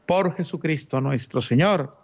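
ordinary talk, nothing marked

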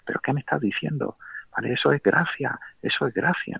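whispered disbelief rising to a high, stunned exclamation; a priest who can't believe what he just heard; retold shock, hushed then high; astonished priest. Salió del confesionario con las manos en la cabeza diciendo.

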